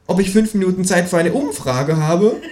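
high kangaroo voice, pleased with itself; the kangaroo gloats about its scheme; cheeky kangaroo. A narrator's reading. Kangaroo on the phone.

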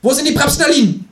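very high, loud kangaroo voice; interrogating kangaroo. A narrator's reading. Kangaroo interrogating the narrator, loud and very high. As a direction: squeal the demand.

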